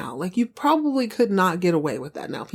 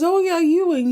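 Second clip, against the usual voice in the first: jolly husband; 10 semitones higher, 4 dB louder than usual. Retold or imitated. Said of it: "he goes".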